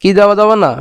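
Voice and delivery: usual speaking voice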